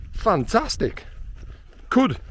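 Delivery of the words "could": "Could" said alone with firm emphasis; "Could" set apart as an emphatic caveat; stress on COULD. Real, not acted. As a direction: lean on the single word "Could". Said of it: "Emphasis on the word could".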